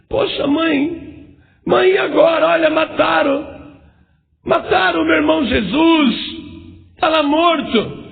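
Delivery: high, slow, grieving cry; tearful lament. Acted. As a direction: drag each word out through the grief. Grieving brother cries to mother. (irmão caçula lamenta chorando para a mãe)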